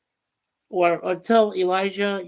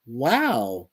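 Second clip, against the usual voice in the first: ordinary talk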